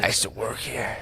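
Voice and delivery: soft unvoiced mock whisper. Friend whispers as a nostalgic ex-bouncer. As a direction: hush it like a confession.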